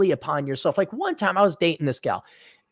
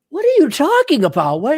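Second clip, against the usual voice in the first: higher-pitched indignant protest; acted-out exasperation; getting angry; 12 semitones higher, 3 dB louder than usual. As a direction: protest in rising irritation. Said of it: "now I'm getting angry".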